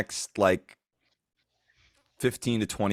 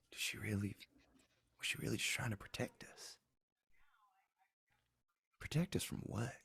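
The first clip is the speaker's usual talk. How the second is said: whispered, softer, thinking aloud in shock; hushed disbelief; 3 semitones higher, 9 dB quieter than usual. Acted. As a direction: murmur it to yourself, unable to believe. In-character stunned musing.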